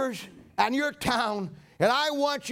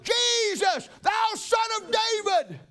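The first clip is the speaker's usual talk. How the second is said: high shouted plea, slow on thou son of David; crying out; 9 semitones higher, slower than usual. Acted. Blind Bartimaeus calls out to Jesus, high pleading shout. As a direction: call out to Jesus over the crowd. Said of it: "And I cried out".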